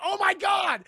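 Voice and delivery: high, loud hammed-up shriek; over-the-top alarmed yell; mock horrified shout. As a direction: cry out in acted horror. Taryn cries out, acted.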